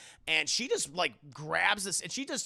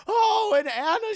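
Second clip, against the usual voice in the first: dramatic mother; 9 dB louder than usual. Retold or imitated.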